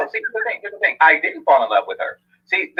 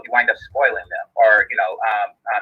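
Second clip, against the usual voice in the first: ordinary delivery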